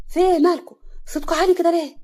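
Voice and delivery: loud, high, startled questions; puzzled surprise. Acted. Mother walking in on her shouting sons, loudly surprised. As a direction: burst in wondering what is wrong. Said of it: "صفية جت باستغراب".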